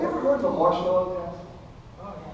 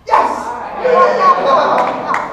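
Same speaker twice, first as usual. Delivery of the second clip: high triumphant shout of joy; excited cheer; 9 dB louder than usual. Real, not acted. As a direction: cheer when the trick works. Trick finally works.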